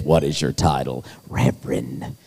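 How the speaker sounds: low-pitched mock official voice; mock solemn. Preacher acting out filling in wedding papers, mock solemn. Reads form, answers with grand title.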